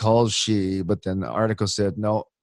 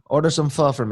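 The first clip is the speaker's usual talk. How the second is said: put-on Vietnamese accent; 3 semitones higher than usual. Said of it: "Vietnamese accent".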